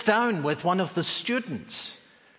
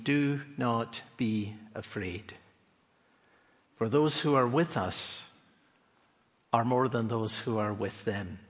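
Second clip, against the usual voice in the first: calm reassurance; 5 semitones lower, 4 dB quieter than usual. Retold or imitated. Elisha reassures, slow and soft.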